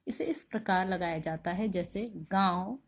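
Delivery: normal talking voice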